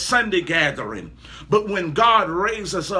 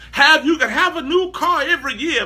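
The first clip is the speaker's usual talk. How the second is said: ordinary delivery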